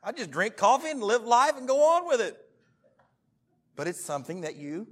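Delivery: ordinary delivery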